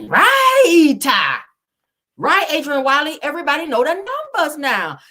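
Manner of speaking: right, belted high and loud; emphatic loud yes; loud agreement. Real, not acted. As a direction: shout your agreement at the chat. Agreeing loudly with a comment.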